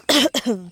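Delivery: sharp cough after swallowing a bug; sudden cough. Real, not acted. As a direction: cough out a gnat. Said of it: "I swallowed a little gnat".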